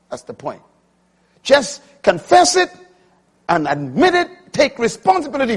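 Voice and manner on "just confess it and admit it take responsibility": full-force preaching. Acted. Preacher warning the congregation, full-force preaching. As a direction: drive it home from the pulpit.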